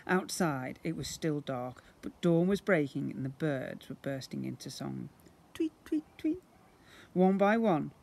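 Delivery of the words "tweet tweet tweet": high chirpy bird tweets; birdsong chirps. A narrator's reading. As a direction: chirp it like a little songbird. Birds bursting into song.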